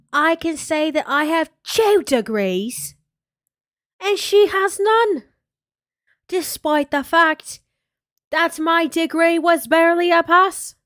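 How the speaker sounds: hammed-up, badly faked British accent, stretching the words. Streamer boasting as the vlogger, in a bad British accent. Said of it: "if I try to do the British accent".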